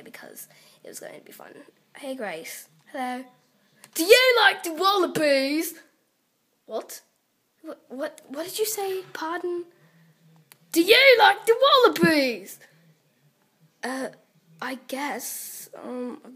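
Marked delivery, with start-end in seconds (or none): 3.9-5.7 loud, high put-on Australian accent. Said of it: "talking in an Au-, Australian accent". 10.7-12.6 put-on loud, high-pitched Australian accent. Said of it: "talking in an Au-, Australian accent".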